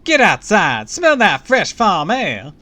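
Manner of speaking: high, booming Southern belle; chipper Southern belle. A narrator's reading. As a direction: boom it out as a very chipper Southern lady. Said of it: "let's give her the Southern".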